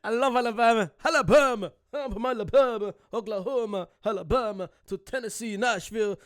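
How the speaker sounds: loud, high, badly faked American accent; terrible American accent. Reactor calling out Southern state names, terrible American accent. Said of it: "That was terrible accent from me".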